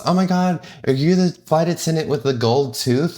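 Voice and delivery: high, excited passenger voice; lightly voiced excited passenger; imitating a passenger. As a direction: do the surprised lady walking off the plane. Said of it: "and she goes".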